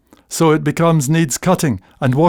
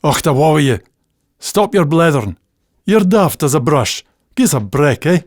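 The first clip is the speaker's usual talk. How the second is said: exasperated Scots put-down; Scots scolding; 2 semitones higher, faster than usual. Retold or imitated. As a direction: tut at them in broad Scots. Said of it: "you'll get".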